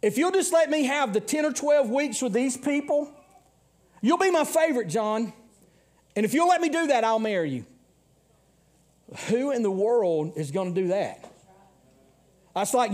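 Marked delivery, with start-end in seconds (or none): 0.0-7.7 mock pleading wife. Wife's voiced bargain in story.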